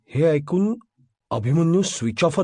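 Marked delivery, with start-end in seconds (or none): none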